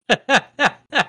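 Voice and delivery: high, loud burst of laughter. Real, not acted. Laughs at the beer can question.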